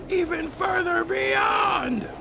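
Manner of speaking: high, drawn-out yell; dramatic shout. Acted. Streamer asking himself how long to stream, dramatic shout. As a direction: belt it out like a battle cry.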